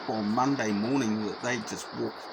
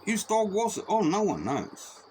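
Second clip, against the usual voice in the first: lightly voiced mock inquiry and shrug; feigned innocence; 9 semitones higher than usual. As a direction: play dumb about the dog.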